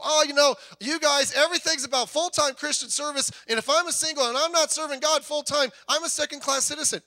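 high loud whiny sigh; hammed-up complaining single; mock complaint. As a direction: mimic the grumbling single. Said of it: "I've heard this criticism".

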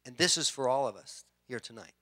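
ordinary talk, nothing marked

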